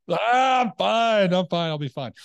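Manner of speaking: put-on loud, high drunken brush-off; acted drunk protest. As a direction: insist loudly like a drunk waving off concern. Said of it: "You're drunk".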